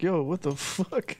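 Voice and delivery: normal talking voice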